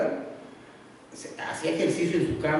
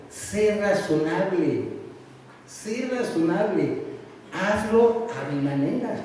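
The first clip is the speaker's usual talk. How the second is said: slow reading of a sign; much slower than usual. Retold or imitated. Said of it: "un letrerito que decía".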